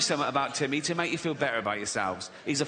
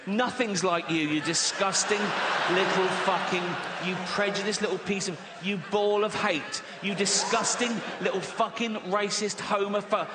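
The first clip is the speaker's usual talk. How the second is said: mounting rant; 4 semitones higher than usual. Acted. Escalating rant at Timmy.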